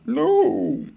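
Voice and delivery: high loud ghostly no; shrieking high ghostly no; ghost dog. Retold or imitated. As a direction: wail like a dead dog's ghost. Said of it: "ghost of my dead dog going".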